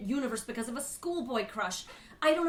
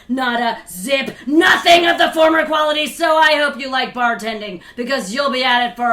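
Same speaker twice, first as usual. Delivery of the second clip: much louder scolding shout; furious shout; 11 dB louder than usual. Acted. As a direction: rage at the students. Teacher berating class.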